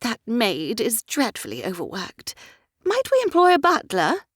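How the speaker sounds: high, excitable lady's voice; fussy society mother. A narrator's reading. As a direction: chatter like a flighty mother of the ton.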